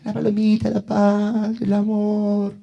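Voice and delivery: cantilena aguda y monótona, en burla; palomita ingenua. Acted. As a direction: parodia aguda de alguien muy pacífico. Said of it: "Ustedes se quedaron con la palomita". (vocecita burlona de palomita)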